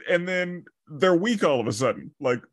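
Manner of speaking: normal talking voice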